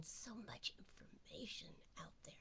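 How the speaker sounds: whispered aside; hushed marvel; whispering. Real, not acted. Soft aside.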